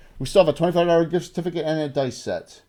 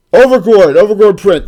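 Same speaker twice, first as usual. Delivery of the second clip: loud, proud prize call; loud announcement; 2 semitones higher, 9 dB louder than usual. Real, not acted.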